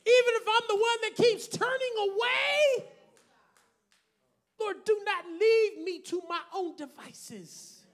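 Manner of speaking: ordinary talk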